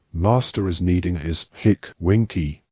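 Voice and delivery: usual speaking voice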